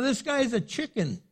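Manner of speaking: normal talking voice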